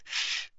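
soft "shh"; quiet warning hiss; shushing. Acted. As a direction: hush someone quickly. Hermione hushing Harry.

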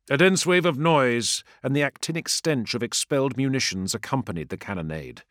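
usual speaking voice

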